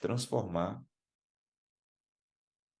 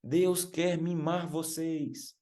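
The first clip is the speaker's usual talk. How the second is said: much higher, slow mock yell; imitated shout; 8 semitones higher, much slower than usual. Preacher repeats the singer's cry to the youths, imitated shout. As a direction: stretch each word high, imitating her call. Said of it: "Gritou umas cinco vezes".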